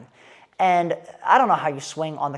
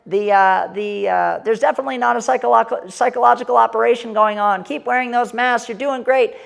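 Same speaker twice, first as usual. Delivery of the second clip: loud, high droning public call; raised, evenly pitched megaphone-style delivery; mock megaphone announcement; 6 semitones higher, 5 dB louder than usual. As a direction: call it out like a sarcastic street announcer. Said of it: "with a megaphone. He was like".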